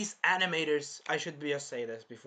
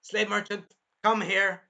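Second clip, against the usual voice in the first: loud, raised call-out; summoning Naofumi; 5 semitones higher, 8 dB louder than usual. Acted. Naofumi summoning the slave merchant, loud and commanding. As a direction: call him over loudly and firmly. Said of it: "and he's like".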